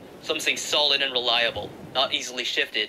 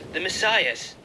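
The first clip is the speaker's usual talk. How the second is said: Messias set higher, falling to rest; awed announcement; 6 semitones higher, slower than usual. Acted. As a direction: declare it as if it changes everything. Andrew announces breathless news.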